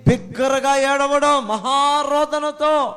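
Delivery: slow and loud, each word stretched; drawn-out emphasis. Shown as a demonstration. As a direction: lean hard on మహారోదనతో, slowly. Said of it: "మహారోదనముతో అంటే ఏంటి?". (మహారోదనతో పదాన్ని నెమ్మదిగా, గట్టిగా సాగదీసి)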